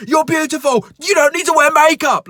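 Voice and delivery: shouting a compliment. Pretend. Cashier imagines yelling praise at a customer, mock shout. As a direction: bark the compliment like a threat. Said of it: "me shouting at you".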